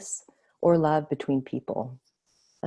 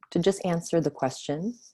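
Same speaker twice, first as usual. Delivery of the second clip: ordinary delivery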